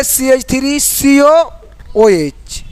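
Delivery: सी एच three सी ओ slowly, then a pause before ओ एच; correct reading, slowly. Shown as a demonstration. Teacher to students, recapping the right reading slowly. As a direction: read slowly in two parts with a break. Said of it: "ऐसे पढ़ना है।".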